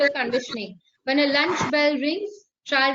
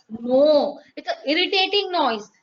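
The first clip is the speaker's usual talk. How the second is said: usual speaking voice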